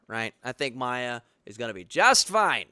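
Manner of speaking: ordinary talk, nothing marked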